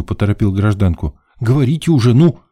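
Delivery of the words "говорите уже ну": higher, hurrying prod; mildly exasperated urging; impatient. A narrator's reading. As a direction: hurry her along impatiently. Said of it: "нетерпеливо переступив с ноги на ногу".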